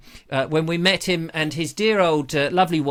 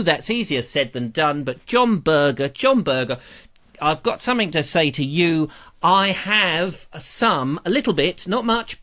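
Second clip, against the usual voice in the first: ordinary delivery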